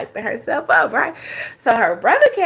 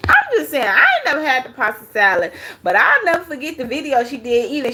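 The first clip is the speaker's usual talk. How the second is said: ordinary talk, nothing marked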